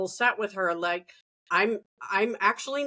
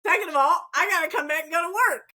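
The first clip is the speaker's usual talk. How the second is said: 1 exasperated explaining; 7 semitones higher, faster than usual. Retold or imitated. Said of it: "And I'm like".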